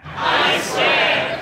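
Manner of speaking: very high hushed echo; crowd echo. Shown as a demonstration. Crowd swearing the evil pledge, very high and hushed. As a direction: hiss it back as a tiny follower. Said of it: "recite the Pledge of Evil Allegiance".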